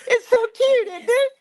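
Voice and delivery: very high, loud squeal of delight; so cute squealed high and loud, dropping on cute. Real, not acted. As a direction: squeal like you just saw a puppy. Squealing over the soap.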